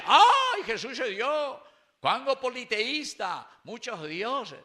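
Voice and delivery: high, loud mocking laugh at the idea; mock a doubter laughing loudly; mocking objector. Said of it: "Entonces uno dice". (burla risueña y aguda)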